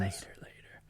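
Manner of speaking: playful whisper, dodging the question. Real, not acted. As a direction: whisper it as a joking aside. Joking aside while the other speaks.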